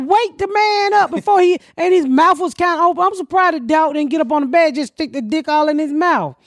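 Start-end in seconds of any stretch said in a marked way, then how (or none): 0.1-1.6 higher, exasperated retold plea; retold urgent protest. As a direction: protest as if to the friends. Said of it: "I'm like".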